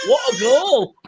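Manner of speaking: loud, high goal shout; long goal yell. Real, not acted. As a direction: yell it as the ball goes in. Goal scored live.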